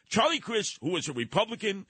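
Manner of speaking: normal talking voice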